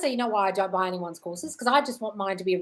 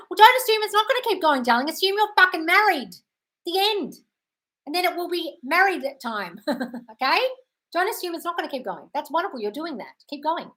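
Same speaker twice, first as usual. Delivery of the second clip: put-on made-up Yolanda accent; 7 semitones higher than usual. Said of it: "Yolanda accent".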